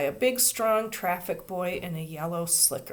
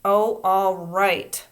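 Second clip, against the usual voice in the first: louder, slow cross grumble; crossly; 8 dB louder, much slower than usual. A narrator's reading. Traffic boy giving in to the crying girl, cross. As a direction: give in grumpily. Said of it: "said Henry crossly".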